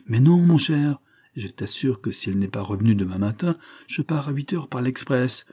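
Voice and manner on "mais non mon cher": higher, louder protest. A narrator's reading. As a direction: push back against the teasing friend. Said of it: "Je protestais".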